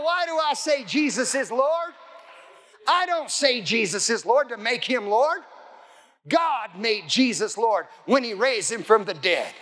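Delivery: ordinary delivery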